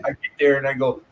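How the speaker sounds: ordinary delivery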